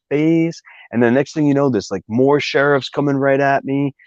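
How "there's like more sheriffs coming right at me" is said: ordinary talk, nothing marked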